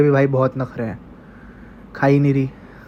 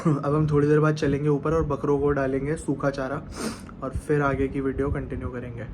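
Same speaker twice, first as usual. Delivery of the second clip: much softer, strained, sick voice; congested and unwell; 8 dB quieter than usual. Real, not acted. Sick vlogger tells viewers he can barely talk, much softer and congested. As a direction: speak as if your chest is blocked and breath is short. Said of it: "इतना ज़्यादा मतलब congestion हो रहा है".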